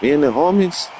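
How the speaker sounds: put-on playful Chicano homeboy accent; mock Chicano street talk; Chicano vato.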